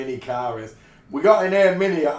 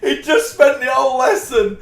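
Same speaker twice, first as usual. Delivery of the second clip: speaking through real laughter, higher and louder; laughing; 7 semitones higher, 5 dB louder than usual. Real, not acted. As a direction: crack up while telling it. Laughing at the punchline.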